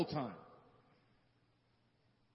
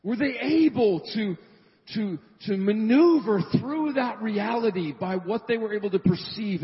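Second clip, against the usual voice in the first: preaching loud; 3 semitones higher, 6 dB louder than usual. Real, not acted. Preaching climax.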